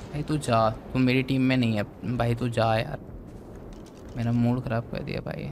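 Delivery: soft, low sulking voice; dejected. Real, not acted. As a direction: quietly send the dino away. Dismissing dino, upset.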